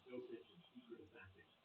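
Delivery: a soft, hushed reveal; dramatic whisper. Pretend. Pitching Bill Clinton as a killer, whispered. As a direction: whisper it like a spooky thriller title. Dramatic conspiracy book title.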